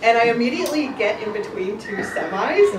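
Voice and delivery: ordinary delivery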